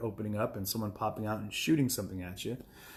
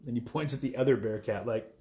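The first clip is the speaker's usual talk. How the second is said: ordinary talk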